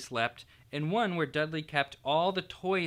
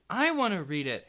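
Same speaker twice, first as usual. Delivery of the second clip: said furiously; 6 semitones higher, 4 dB louder than usual. A narrator's reading. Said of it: "Harry said furiously".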